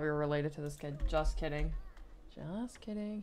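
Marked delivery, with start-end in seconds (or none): 2.3-3.2 repeated softly as a private aside; softer aside. Acted. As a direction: drop the volume and toss it off.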